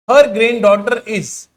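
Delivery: very slow, word by word, like dictating; slow dictation reading. Shown as a demonstration. Teacher reading the exam question to students, slow dictation. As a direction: spell the question out slowly for the class.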